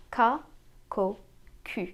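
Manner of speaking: ka, ko, ku with a crisp k; French hard C on ka, ko, ku. Shown as a demonstration. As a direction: say ka, ko, ku one by one, French vowels. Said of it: "these are the hard C sounds".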